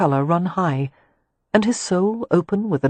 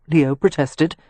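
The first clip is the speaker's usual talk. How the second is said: ordinary delivery, nothing marked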